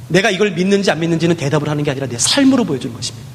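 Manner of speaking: ordinary talk, nothing marked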